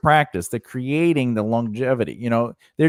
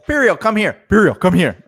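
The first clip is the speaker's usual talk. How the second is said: calling the dog; 7 semitones higher, 5 dB louder than usual. Real, not acted.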